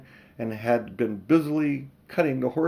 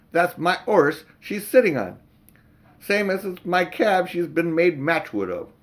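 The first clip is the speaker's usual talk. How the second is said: indignant cabby; 6 semitones higher, 4 dB louder than usual. Acted. Cabby complaining, retake.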